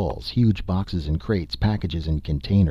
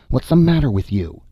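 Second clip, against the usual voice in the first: high-pitched, exasperated call; moderately raised shout, pitched up; 7 semitones higher, 4 dB louder than usual. A narrator's reading. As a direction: call out a scolding question. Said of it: "Dorley shouted".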